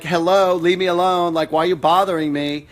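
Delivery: put-on loud, high, annoyed teen; attitude. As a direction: push mom away with sass. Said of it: "It's almost like she's talking with an attitude".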